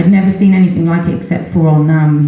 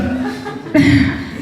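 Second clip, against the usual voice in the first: real laugh; 12 semitones higher, 5 dB quieter than usual. Real, not acted. Laughing at doctors' absurd remark.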